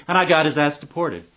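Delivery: usual speaking voice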